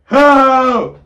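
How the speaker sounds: high, loud cry of help; screaming for help. Retold or imitated. Running from the Scream guy, yelling for help. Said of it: "I'm like".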